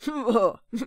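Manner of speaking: loud hearty giant laugh; good-natured giant chuckle. A narrator's reading. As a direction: laugh out big and pleased. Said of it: "sagte er lachend".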